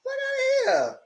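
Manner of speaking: very high squeaked cussing; mock indignation. Playing the pissed-off stripper, mock outraged squeak. Imagines being the annoyed stripper.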